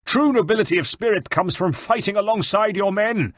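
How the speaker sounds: high-pitched, impassioned retort; indignant rebuke. A narrator's reading. Captive general rebukes his wife's kidnapper, indignant. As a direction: shame the enemy for his cowardice. Bartolomeo angrily lectures de Valois.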